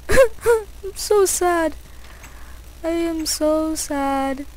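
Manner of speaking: high, slow whiny mock sadness; drawn-out pretend sulk, hammed up; mock sad. Gamer mock-sulking over the lost server, whiny and slow.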